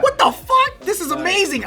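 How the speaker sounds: very high laughing disbelief; amazed squeal. Retold or imitated. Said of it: "I'm like".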